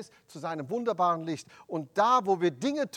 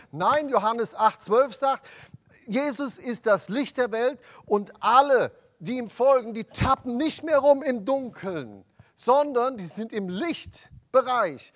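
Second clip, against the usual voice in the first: loud, emphatic sermon delivery; full-force preaching; 4 semitones higher, 8 dB louder than usual. Acted. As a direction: preach it out with conviction.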